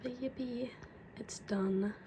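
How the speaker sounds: soft whispered cheer; whispered yippee. Real, not acted. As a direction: whisper it like a tiny celebration. Quiet celebration while editing.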